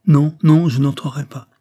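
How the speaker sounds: a loud, high, firm no; emphatic spinster refusing. A narrator's reading. Cornélie refusing Joyce, loud and firm at first. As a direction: refuse sharply, then settle.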